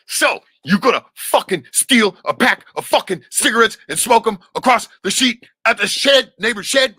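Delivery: father yelling at his son during a whipping, enraged; father's furious yell. Retold or imitated. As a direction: spit each word while swinging the belt. Father berating son while whipping.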